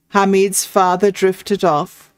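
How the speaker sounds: usual speaking voice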